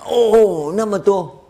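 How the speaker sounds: high, loud gasp of dismay at the number; surprised student. Retold or imitated. Student hearing ten thousand recitations, shocked and high. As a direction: balk at the huge count, pitch jumping up.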